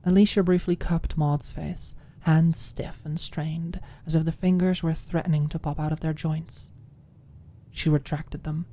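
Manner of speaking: ordinary delivery, nothing marked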